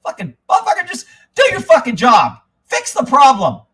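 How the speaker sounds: loud, high-pitched angry demand; exasperated outburst. Acted. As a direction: burst out loudly after holding it in. Drops mock whisper, bursts out.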